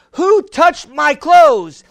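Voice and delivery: slow, loud call to the crowd. Acted. Jesus calls to the crowd.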